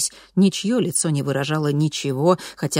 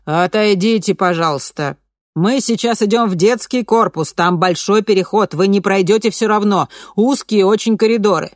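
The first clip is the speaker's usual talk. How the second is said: loud official address; 5 semitones higher, 6 dB louder than usual. A narrator's reading. Official addresses crowd of mothers.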